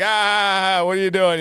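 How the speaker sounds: high mocking kid voice. Boy mocking his screaming sister, high and teasing. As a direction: tease her like a silly kid. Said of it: "We're like".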